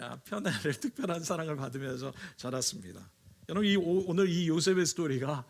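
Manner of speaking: ordinary delivery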